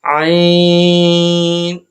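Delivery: عین held very long, clean from the throat; correct recitation. Shown as a demonstration. Teacher giving students the correct عین, long and steady. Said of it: "تو اس کی صحیح ادائیگی".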